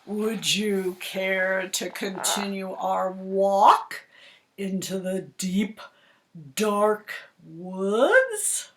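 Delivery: slow, smooth fake-polite fox; sly polite fox. Fox luring the goose on a stroll, slow and sly. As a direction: sweet-talk her slowly.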